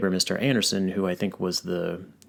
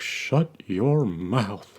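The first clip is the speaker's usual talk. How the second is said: very slow, clipped Texan dad order; Hank Hill voice; 3 semitones higher, much slower than usual. Retold or imitated. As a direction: drag out the rebuke. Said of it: "Hank's like".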